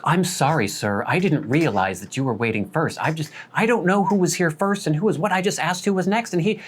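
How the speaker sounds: a touch of the flustered deli worker; defensive apology. Retold or imitated. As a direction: rush to excuse yourself. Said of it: "And she's immediately defensive".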